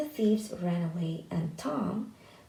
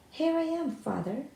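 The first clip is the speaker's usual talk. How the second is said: calling out; 8 semitones higher than usual. A narrator's reading. Tom calling proudly to his waiting father. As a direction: call out proudly across the clearing. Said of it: "Tom called".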